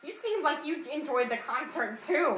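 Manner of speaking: put-on funny kid voice. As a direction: mimic a nerdy kid's funny voice. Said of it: "that is exactly how he talks".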